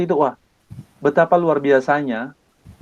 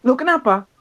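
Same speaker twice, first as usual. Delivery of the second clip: surprised father; 9 semitones higher than usual. Retold or imitated. Father asks why on phone.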